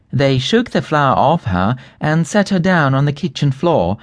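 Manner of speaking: ordinary delivery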